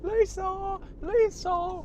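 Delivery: very high, loud call for a lost dog. Retold or imitated. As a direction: shout the name across the park. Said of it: "run around going".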